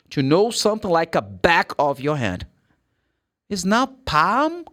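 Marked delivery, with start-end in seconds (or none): 4.0-4.6 PALM said questioningly, rising at the end; higher, questioning "palm"; wrong pronunciation. Shown as a demonstration. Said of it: "How do you pronounce this?".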